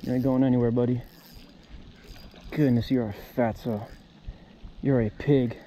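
ordinary talk

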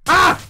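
loud startled yelp; sudden high shriek; panicking gamer. Real, not acted. Undyne appears right next.

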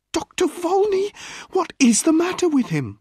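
very high, anxious wife's voice; woman crying out. A narrator's reading. Wife begging the doctor about her husband, high and distraught. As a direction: beg the doctor for an answer. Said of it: "the woman cried".

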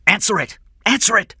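very high, loud repeated command; urgent shout. Acted. Detective urging King to pick up the phone, urgent shout. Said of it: "Corella shouted".

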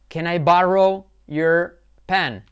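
slow, loud "Can I borrow your pen", spaced out; "borrow" with an open A, phrase by phrase; teacher's model. Shown as a demonstration. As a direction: stretch "borrow" as "BA-rou", then "your" and "pen". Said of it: "Cuidado con el borrow".

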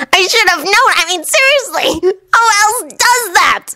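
high-pitched exasperated rant; agitated Anko. Acted. Anko realises she missed her enemy, high and exasperated. Voices Anko berating herself.

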